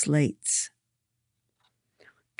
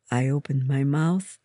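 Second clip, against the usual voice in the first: plain reading voice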